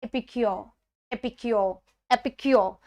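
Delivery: epicure repeated as "EP-i-kyo", each take falling; model pronunciation. Shown as a demonstration. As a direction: say epicure in separate takes, ending "-kyo" with no r. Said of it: "pronunciation है".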